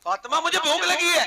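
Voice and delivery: calling out high and loud into the house; a husband calling out to Fatima in hunger. Retold or imitated. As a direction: call loudly to the wife, hungry. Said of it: "मैंने भूख से जोर से आवाज दी".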